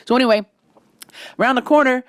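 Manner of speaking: ordinary delivery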